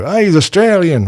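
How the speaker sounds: high, mocking clueless American voice; tossing it off like a know-it-all tourist. Mimicking Americans who assume Sam Neill is Australian, high and mocking. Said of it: "they do it with Sam Neill, too".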